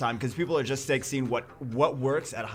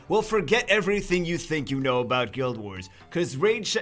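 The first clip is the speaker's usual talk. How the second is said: loud, high infomercial pitch; announcer voice; 4 semitones higher, 5 dB louder than usual. Pretend. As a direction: hype it like a late-night ad. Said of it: "I get in the announcer voice".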